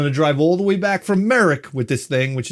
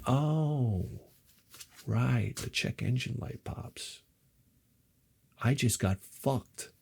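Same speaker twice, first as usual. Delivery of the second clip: hushed, slow, sinking disbelief; deflated realization; 11 dB quieter, slower than usual. Retold or imitated. Owner realizing he got fucked, quietly deflated. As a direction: let it sink in, nearly under the breath.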